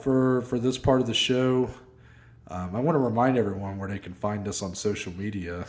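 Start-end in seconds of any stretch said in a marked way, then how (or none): 2.5-5.6 deflated, lower and softer; disappointed. Real, not acted. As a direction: sound let down by the sign-off. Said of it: "You said that so, like, disappointed".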